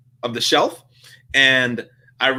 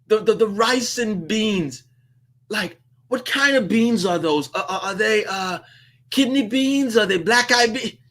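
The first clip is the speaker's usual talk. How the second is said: high, hesitant voice of a nervous first-timer; unsure newcomer fumbling through an order; timid confused customer; 8 semitones higher than usual. Retold or imitated. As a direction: stammer out the order like you don't know the menu. New customer asks about beans.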